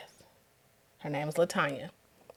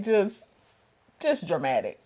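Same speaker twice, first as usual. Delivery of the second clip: usual speaking voice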